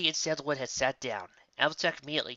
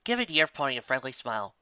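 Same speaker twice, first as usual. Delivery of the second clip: usual speaking voice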